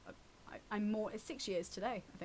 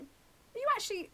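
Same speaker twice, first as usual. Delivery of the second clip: incredulous; 4 semitones higher, 5 dB louder than usual. Retold or imitated. Incredulous retold reply to clients.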